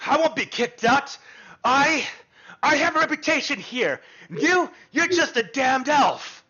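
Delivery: rising into a high, loud, arrogant rant; arrogant threat. Acted. Tobin, the proud student mage, threatening the elf, furious.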